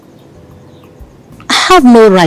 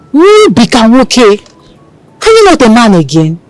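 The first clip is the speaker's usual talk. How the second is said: teasing goad; 3 semitones higher, 3 dB louder than usual. Acted. Woman goading her lover to be a man, teasing. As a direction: needle him playfully.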